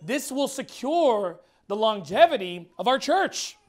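normal talking voice

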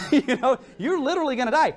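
high, amused laugh through the words. Real, not acted. Laughs at his own blunt warning.

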